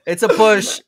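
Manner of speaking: high, loud laughing; laughing concession. Real, not acted. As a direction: concede while cracking up.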